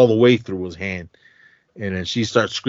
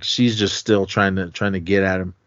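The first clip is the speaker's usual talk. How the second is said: normal talking voice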